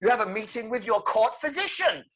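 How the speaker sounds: high, mock-courtly proclamation; theatrical Joker announcement. Joker calls Batman to his next trial, mock-courtly announcement.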